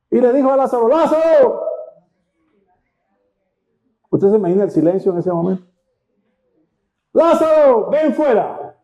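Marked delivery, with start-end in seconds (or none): 0.9-1.6 high, raised call by name; commanding call. Acted. Acts Jesus calling Lazarus out. 7.1-8.8 high, ringing summons; commanding call. Acted. Acts Jesus summoning Lazarus.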